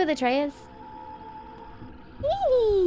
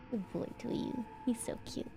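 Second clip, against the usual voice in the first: soft whisper to a pet; 14 dB quieter than usual. Real, not acted. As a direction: breathe it gently to the dog.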